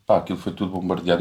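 usual speaking voice

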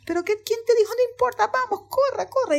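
high, hurried storybook alarm; urgent panic. Acted. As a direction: urge everyone to flee now. Animals' urgent exchange in panic. (pánico actuado, agudo y urgente)

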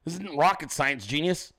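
ordinary talk, nothing marked

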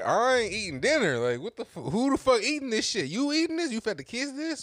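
petulant complaint, replayed; retold little fit. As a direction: throw a retold fit at the wife. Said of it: "So I threw a little fit".

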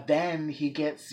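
ordinary talk, nothing marked

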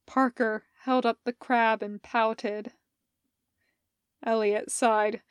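plain reading voice

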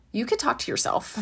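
ordinary talk

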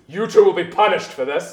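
a hint of a stern demon boss; mild menacing rebuke. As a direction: threaten the underlings. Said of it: "and she says".

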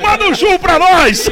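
loud, high, slow playful shout; excited shout. Real, not acted. Host shouting a joke request to churro vendors, excited. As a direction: call out to the churro guys across town.

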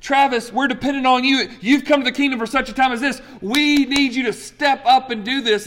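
high, urgent, fast voice of God calling; God calling him. Retold or imitated. God calls Travis to step up, voice high and urgent. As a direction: call him up like a coach who needs him now. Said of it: "you positioned me in places like Esther saying".

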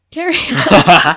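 loud, slow mock command; grand carry on. Real, not acted. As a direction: wave them on like a queen. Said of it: "hands over to co-host".

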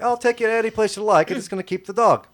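high, loud urchin voice fawning over the dog; cheeky urchin sweet-talking a giant dog; street kid voice. Acted. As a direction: coo at the big dog like a scrappy street kid.

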